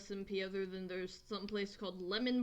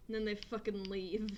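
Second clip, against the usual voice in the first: normal talking voice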